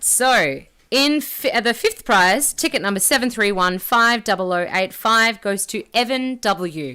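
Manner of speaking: booming prize announcer. Acted. Raffle winner announced slowly.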